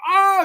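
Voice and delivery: sudden high shriek of pain; short hammed-up shriek; mock scream. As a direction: yelp like glass just hit her eyes. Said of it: "She's like".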